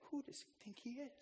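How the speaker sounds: preacher voicing the scribes' silent scoff; hushed inner thought. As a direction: say it under your breath.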